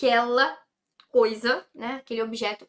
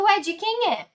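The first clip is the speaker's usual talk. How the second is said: asking whose it is; 8 semitones higher, 3 dB louder than usual. Acted. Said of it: "alguém pergunta".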